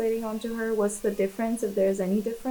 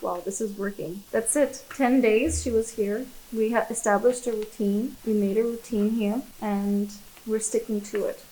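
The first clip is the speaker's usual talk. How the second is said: ordinary talk, nothing marked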